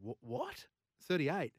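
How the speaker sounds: a sudden high startled what; shocked disbelief. Retold or imitated. Host retelling the moment he learned the real odds, shocked. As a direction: caught off guard, voice jumping up.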